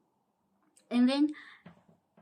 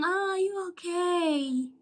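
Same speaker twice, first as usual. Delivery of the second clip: high, slow mock concern; mock caring voice; 7 semitones higher, 5 dB louder, slower than usual. Mocking the nurses who never asked how she was, high and slow. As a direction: imitate a fake-caring nurse. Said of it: "No one is saying".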